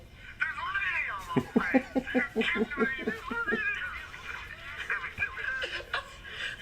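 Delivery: long high giggling fit; uncontrollable laughing. Real, not acted. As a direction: crack up helplessly. [name] laughs at video.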